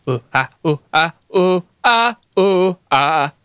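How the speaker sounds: stalling noises while thinking; rhythmic oohs and ahs. Real, not acted. Noises while trying to remember.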